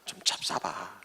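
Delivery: whispered, low Sangju dialect coaxing; warm whispered offer. Retold or imitated. Jesus urging Peter to eat, tender whisper. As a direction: urge him gently, in a whisper. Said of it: "따뜻한 표현으로".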